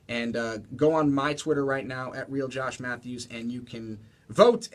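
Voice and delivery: loud upbeat radio announcer read. Acted. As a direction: sell the show like a DJ. Radio show plug.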